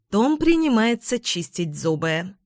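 usual speaking voice

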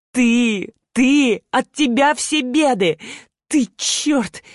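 high, anguished tearful outburst; choking on tears. A narrator's reading. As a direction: wail the blame, dragging the words. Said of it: "давясь слезами".

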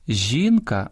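"Жінка" stressed on the first syllable, жІнка. Shown as a demonstration. Host showing singular stress, "Жінка" with first-syllable stress. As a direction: lean on the І of "Жінка". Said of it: "зберігають наголос однини".

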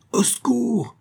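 very high, piercing call for help; high-pitched alarm cry. A narrator's reading. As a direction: cry out piercingly, as if across a square. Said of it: "d'une voix si perçante qu'on put l'entendre".

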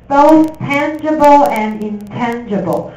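慢慢念出tangible和intangible; 一字一字放慢示范; slow read-aloud. Shown as a demonstration. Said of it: "老师示范念课文". (老师放慢示范念英文)

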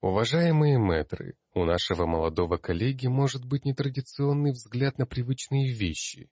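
high, projected address to the masters; announcing. A narrator's reading. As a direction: formally appeal to a learned assembly. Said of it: "объявил".

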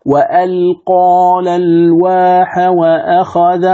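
slow chanted "وألقى الألواح وأخذ" with the vowel after ق drawn out long; level tajweed chant, over-lengthening the ق vowel in "وألقى"; wrong madd. Shown as a demonstration. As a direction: recite slowly, stretching qaa in "وألقى" before "الألواح". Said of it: "هذا خطأ".

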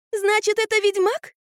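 high, thrilled question; bright burst of delight. A narrator's reading. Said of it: "обрадовалась Орфа". (радостно, высоким голосом)

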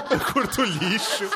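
laughing. Real, not acted. Repeats line laughing.

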